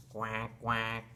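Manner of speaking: low, softened duck quacks; duck quacking. Acted. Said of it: "Mother duck is quacking".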